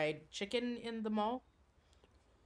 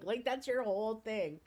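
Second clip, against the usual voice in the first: normal talking voice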